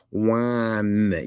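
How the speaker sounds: "Wine" with a long held N; exaggerated final N. Shown as a demonstration. Streamer reading the spelling with eight N's, "Wine" ending in a long N. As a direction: hang on the N at the end of "Wine". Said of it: "You put eight N's in there".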